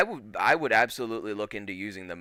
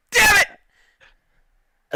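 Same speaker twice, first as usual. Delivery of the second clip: much higher, loud exasperated yell; frustrated shout; 10 dB louder than usual. Retold or imitated. Reacting to hearing the accent again, loud frustrated yell. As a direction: cry out in mock despair. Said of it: "and I'm like".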